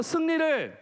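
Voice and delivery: rallying call-and-response lead; leading a repeat-after-me. Shown as a demonstration. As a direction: call it out for the children to echo. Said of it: "다시 한번".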